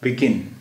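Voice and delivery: Begin with the second syllable गिन leaned on; stress on second syllable. Shown as a demonstration. Teacher showing students how begin is said, second syllable stressed. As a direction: say begin, landing firmly on गिन. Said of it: "आई के नीचे ये छोटी सी लकीर है".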